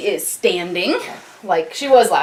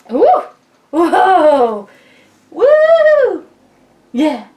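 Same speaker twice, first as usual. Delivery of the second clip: excited cheering; 11 semitones higher, 8 dB louder, much slower than usual. Real, not acted. Mom cheering baby on.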